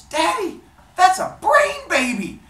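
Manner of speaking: high, loud, drawn-out squeal of wonder; amazed exclamation. Acted. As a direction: cry out to Daddy in hammed-up amazement. Hephaestus amazed at the newborn.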